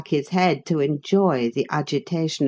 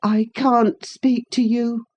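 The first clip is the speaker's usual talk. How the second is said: put-on murmuring invalid boy; 7 semitones higher than usual. A narrator's reading. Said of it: "he murmured".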